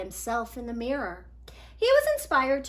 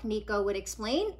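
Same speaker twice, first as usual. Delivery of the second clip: put-on lively read-aloud for kids; storybook reading; 3 semitones higher, 4 dB louder than usual. A narrator's reading. Reader telling children a picture book story, lively.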